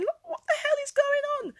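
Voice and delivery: very high, loud, incredulous demand; bewildered outburst. Retold or imitated. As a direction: re-enact a panicked, baffled outburst. Acting out bewilderment at crying relatives.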